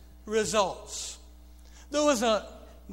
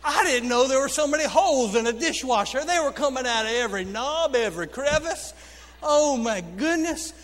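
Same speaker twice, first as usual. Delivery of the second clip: louder, fast, astonished rush; amazed; 3 semitones higher, 5 dB louder than usual. Real, not acted. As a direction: pile it up in disbelief. Suds flooding the kitchen.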